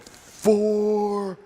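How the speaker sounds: stretched imitation of a ref counting; referee's count. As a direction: call it out like a ring referee. Referee counting aloud in ring.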